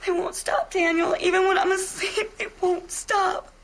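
distraught. Acted. Reenacted wife breaking down.

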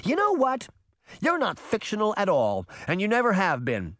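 high, exasperated outburst; heated girl's outburst; crying out. A narrator's reading. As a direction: cry it out high, fed up with him. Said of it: "Sabrina cried".

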